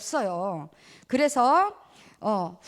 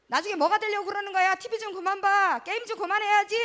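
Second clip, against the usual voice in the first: higher, fast mock scolding; nagging mom; 7 semitones higher, 3 dB louder than usual. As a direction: rattle off a mom's nagging. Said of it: "엄마가". (빠르게 잔소리하는 엄마 흉내)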